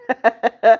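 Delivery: short burst of chuckles; a quick, amused ha-ha-ha; laughing. Real, not acted. Streamer amused by viewer's bunny rabbit joke, laughing.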